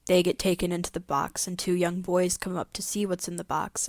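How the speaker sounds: usual speaking voice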